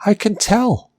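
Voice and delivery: high-pitched, lightly bewildered retort; imitated bewilderment. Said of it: "this bewildered look on his face".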